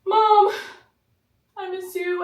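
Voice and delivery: high, loud wail for mom; crying child. Retold or imitated. Child calling after departed mom, mock crying wail. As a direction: imitate a kid bawling at the door. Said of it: "he's, like, at the door like this".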